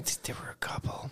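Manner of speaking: secret whisper. Real, not acted. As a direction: whisper it so nobody hears. Hushed gossip about a girl.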